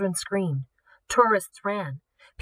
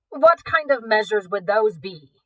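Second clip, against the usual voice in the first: slow, louder, formal butler; 3 semitones higher, 6 dB louder than usual. A narrator's reading. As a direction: ask it with polite suspicion. Marcus the butler asks.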